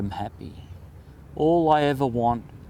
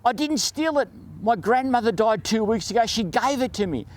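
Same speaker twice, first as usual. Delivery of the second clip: imitating a panicked kid's protest, pitched high; a student pleading his innocence, half-hearted mock yell; retold yell; 8 semitones higher than usual. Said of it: "he's yelling".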